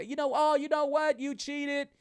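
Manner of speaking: high, sing-song mimicry of online advice; mocking social media advice. Host mocking TikTok advice to cheaters, in a high sing-song voice. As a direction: parody the TikTok coaches.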